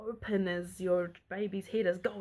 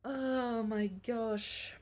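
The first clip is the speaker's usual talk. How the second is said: relieved sigh; 3 semitones higher, much slower than usual. Real, not acted. Relief after the birth.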